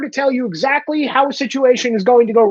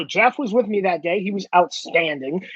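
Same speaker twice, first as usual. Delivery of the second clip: ordinary delivery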